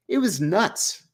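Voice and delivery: normal talking voice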